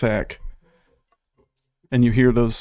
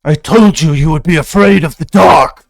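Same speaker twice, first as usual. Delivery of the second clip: very loud, raised-pitch villain taunt; maniacal villain; 5 semitones higher, 13 dB louder than usual. Acted. Game master as the monster taunting the party, loud maniacal villain. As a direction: boom it out with menacing relish. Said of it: "He laughs maniacally as he says".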